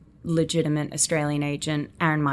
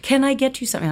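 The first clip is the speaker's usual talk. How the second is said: high, bright mock-helpful offer; eager American server; 9 semitones higher, faster than usual. Actress mimicking American staff offering her service, high and eager. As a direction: imitate an overly attentive American assistant. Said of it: "suddenly everyone's like".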